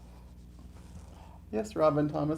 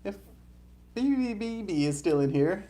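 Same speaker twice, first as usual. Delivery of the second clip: ordinary talk, nothing marked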